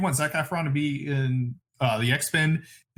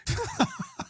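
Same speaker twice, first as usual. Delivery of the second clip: real high-pitched burst of laughter; a quick squealing laugh; 5 dB quieter than usual. Real, not acted. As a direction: crack up at your own joke. Laughing at her own joke.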